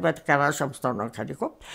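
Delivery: low, hurried parenthetical remark; quick aside. A narrator's reading. Storyteller explaining the missing garage to listeners, quick low aside.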